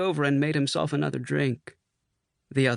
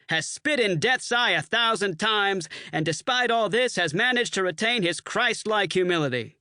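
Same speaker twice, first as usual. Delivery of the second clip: higher, hammed-up solemn toast; grand mock toast; 7 semitones higher than usual. A narrator's reading. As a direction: orate grandly tongue-in-cheek. Bull gives mock toast.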